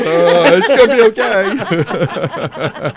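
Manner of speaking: high, loud, drawn-out comic wail; mock reassurance. Husband retelling comforting his crying wife, mock reassurance. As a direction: stretch out a laughing, panicked comfort.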